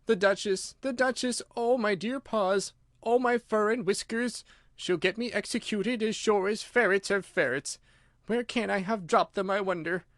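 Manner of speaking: high, fretful rabbit voice; anxious rabbit muttering. Acted. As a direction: fret to yourself in panic. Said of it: "muttering to itself".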